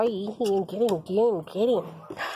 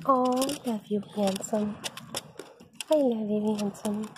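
normal talking voice

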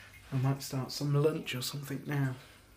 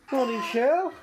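cheery morning greeting; 10 semitones higher, 5 dB louder than usual. Real, not acted. Greeting family member, high voice.

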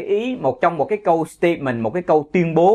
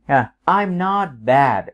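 ordinary talk

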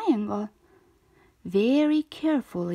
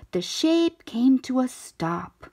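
ordinary delivery

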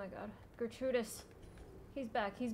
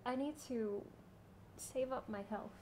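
quiet; 3 semitones lower, 13 dB quieter than usual. Real, not acted. Quietly focusing on boss fight.

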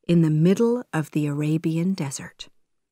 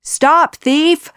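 high, loud chasing shout; urgent, full-voiced yell; guards' shout; 10 semitones higher, 8 dB louder than usual. A narrator's reading. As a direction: shout to halt a thief. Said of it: "the guards shouted".

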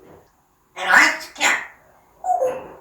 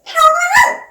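high cooing; 7 dB louder, faster than usual. Real, not acted. Cooing at the pet.

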